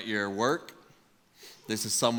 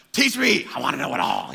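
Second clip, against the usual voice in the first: eager; 5 dB louder than usual. Retold or imitated. Acting out his hunger to learn.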